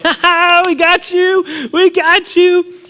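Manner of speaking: high, loud drawn-out taunt; gleeful gloating. Retold or imitated. Pranksters laughing at their victim, high gloating shout. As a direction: crow over the victim, stretching it out. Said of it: "we're laughing and we're like".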